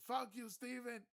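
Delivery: very high, loud yelled insult; mock yell. Guy cursing Steven across the dock, loud high yell. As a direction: bellow it across the water. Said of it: "This guy goes".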